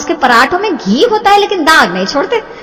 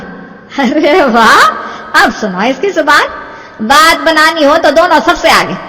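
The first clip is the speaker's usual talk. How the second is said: mocking taunt; 4 semitones higher, slower than usual. Mother-in-law mocks daughter-in-law.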